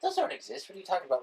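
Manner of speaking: high-pitched dismissive teacher quote. Retold or imitated. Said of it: "she was like".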